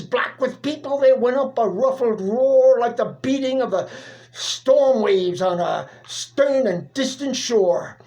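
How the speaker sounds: usual speaking voice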